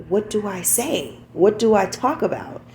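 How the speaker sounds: ordinary delivery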